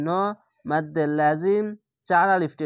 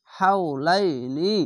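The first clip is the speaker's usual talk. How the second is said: drawn-out reading; slower than usual. Shown as a demonstration. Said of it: "এক আলিফ টেনে পড়বেন".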